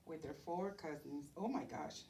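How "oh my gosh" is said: ordinary delivery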